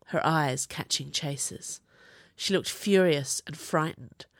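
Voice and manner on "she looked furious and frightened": usual speaking voice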